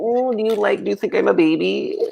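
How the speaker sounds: high mock-innocent baby voice; coy helpless act. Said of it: "I'm like".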